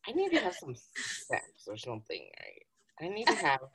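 hushed whispered craving; dreamy whispered aside. Retold or imitated. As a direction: whisper it like a secret confession. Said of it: "I was just like".

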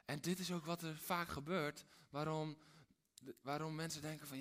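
normal talking voice

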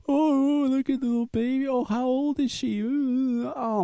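put-on high, sing-song cooing over a baby; mock stranger cooing. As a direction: gush like a stranger leaning over the stroller. Mocking strangers fawning over baby.

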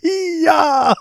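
high mock karate shout "Hiya"; hammed-up kung fu yell; imitating a karate yell. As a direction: bark out a cartoon martial-arts cry.

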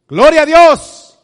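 loud, high, slow cry of praise; shouted praise. Acted. Believer loudly praising God after losing everything. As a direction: shout it to the rafters.

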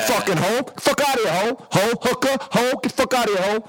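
high, repeated mock-furious insults; telling her off. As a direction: rattle off insults as if throwing someone out. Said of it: "I told her off".

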